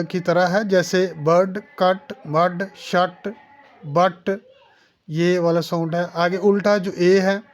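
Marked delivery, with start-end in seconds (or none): 1.2-4.4 बर्ड, कट, मड, शट, बट with a brief uh; very short vowel. Shown as a demonstration. Teacher demonstrating the cut sound, very short uh in कट, शट, बट. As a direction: clip the uh in कट, मड, शट, बट. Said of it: "ये बिल्कुल short ज़बर है".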